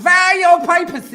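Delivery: high, loud mock German officer; high, loud hammed-up German; German guard accent. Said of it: "Instead of going".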